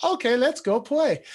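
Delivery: lightly voiced toddler heading off to play. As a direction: play the three-year-old eager to play. Dad voicing his toddler son.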